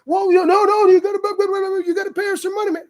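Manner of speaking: high, pushy mock driver voice. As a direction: squeeze them for cash in a high put-on voice. Driver demanding money voiced.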